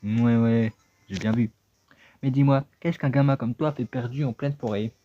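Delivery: put-on a cranky little creature ordering the kid to help; impatient talking hat. Same character voice.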